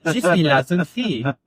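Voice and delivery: put-on high, playful French accent; singsong put-on French, high; mock French. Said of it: "I just remember that was Muzzy".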